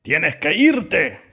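slow, loud, high wolf command; stern order. A narrator's reading. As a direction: send the lamb away forcefully, stretching it. Exasperated wolf orders lamb out. (orden firme y fuerte)

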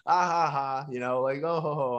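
loud, high, put-on chuckle; acted laughing-off. Skater brushes off a band's tour invitation, mock laugh. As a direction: fake a laugh to deflect the offer.

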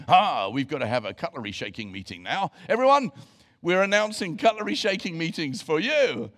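loud, high, hammed-up church notice; preacher mocking a cutlery-shaking meeting, loud and hammy; mock announcement. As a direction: announce it like a silly gimmick.

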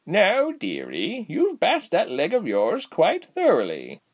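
put-on high, slow, swooping old lady voice; cheerful old woman. A narrator's reading. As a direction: draw it out sweetly like a cheery granny. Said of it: "she said cheerfully".

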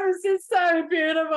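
high laughing gush. Retold or imitated. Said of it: "I was like".

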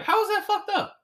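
squeaky high "how is that fucked up?"; mock indignant. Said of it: "versus, like".

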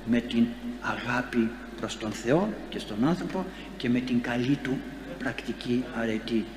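usual speaking voice

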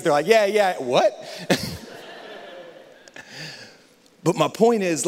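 usual speaking voice

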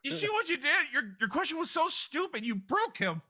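high-pitched, indignant telling-off; mock scolding. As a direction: scold them in a high character voice. Mock scolding of another character.

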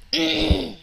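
sharp high throat clear. Real, not acted. Clears throat before speaking.

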